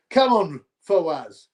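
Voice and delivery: ordinary talk, nothing marked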